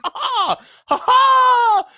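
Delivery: loud, high poltergeist laughter; shrieking high loud cackle; gleeful high cackle. Acted. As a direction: cackle in triumph at the trick. Peeves cackling.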